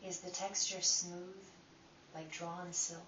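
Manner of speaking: calm, unhurried guiding voice with long pauses; guided meditation. Acted. Teacher guiding listeners through breath inquiry, calm meditation voice. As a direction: lead them inward one gentle question at a time.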